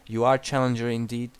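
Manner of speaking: normal talking voice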